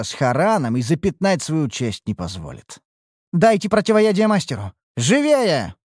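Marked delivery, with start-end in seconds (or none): 3.3-5.8 urgent orders, pitched up; commanding. A narrator's reading. Leitaris orders servants to give the master antidote, commanding. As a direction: rap out brisk commands, voice raised a little. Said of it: "скомандовала Лейтарис".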